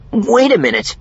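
high, loud, startled character voice; puzzled protest; confused interjection. A narrator's reading. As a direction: break in, confused and high-pitched. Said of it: "his eyes looking confused again".

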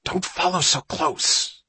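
high, slow, tense hiss; hissed warning. Acted. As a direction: hiss it back as a sharp order. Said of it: "Johnny hissed".

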